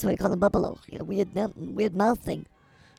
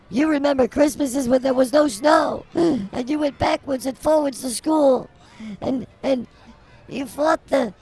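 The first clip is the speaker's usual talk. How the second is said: building mock rant; 5 semitones higher than usual. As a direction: overblown praise rising to a yell. Mock tribute rant, cut by "shut up.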